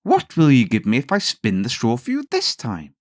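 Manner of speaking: a scheming little imp asking his price; little man. A narrator's reading. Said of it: "the little man ... said".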